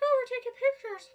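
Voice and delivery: high tourist voice. Retold or imitated. Tourists voiced taking pictures.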